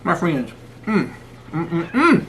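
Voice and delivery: slow drawn-out mm of pleasure; mouth-full yummy mm sounds; savoring hum. Real, not acted. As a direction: hum over the food like it's heaven. Savoring a bite of ribs.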